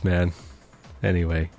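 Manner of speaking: normal talking voice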